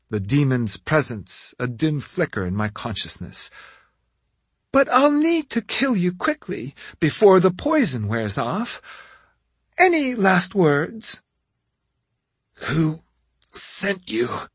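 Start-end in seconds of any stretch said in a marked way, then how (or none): 4.7-11.2 taunting witch. A narrator's reading. Witch threatens the hero. 12.7-14.5 strained, forced through teeth; gritted teeth. A narrator's reading. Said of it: "I gritted out".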